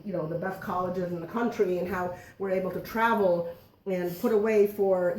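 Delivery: ordinary talk, nothing marked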